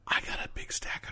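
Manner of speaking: whispering. Real, not acted. Aside about a backlog of reading.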